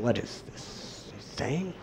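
wondering under his breath what he meant; puzzled aside. Retold or imitated. Murari puzzling over Nimai's words, softly and slowly.

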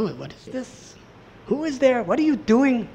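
high, soft, alarmed asking; who is there, caught off guard; startled questions. Retold or imitated. Murari startled by the stranger at lunch, high and hushed.

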